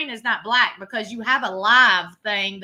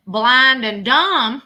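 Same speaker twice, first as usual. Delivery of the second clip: slow and louder, each word weighed; slow verdict; 8 dB louder, much slower than usual. Real, not acted. Streamer judging photos, slow and louder. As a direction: spell out the verdict.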